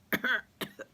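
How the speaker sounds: high hacking cough. A narrator's reading. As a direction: cough up the stench. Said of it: "He coughed".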